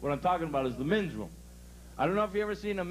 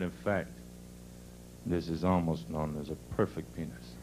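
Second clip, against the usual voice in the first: dad giving his son a solemn lesson; explaining father; 14 semitones lower, 4 dB quieter than usual. Acted. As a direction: explain it gravely, low and measured. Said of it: "His father naturally saw what the kid was staring at".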